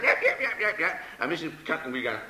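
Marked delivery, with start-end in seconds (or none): 0.0-1.0 high, rapid little yaps; yapping dog. Acted. Puppy racing round the chair, high excited yapping. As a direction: yap like a small excited dog. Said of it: "and the dog went".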